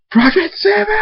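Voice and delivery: very high-pitched, strained scream. Acted. Thunderstorm calling out his enemy, furious high scream. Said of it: "flies out of the castle and screams".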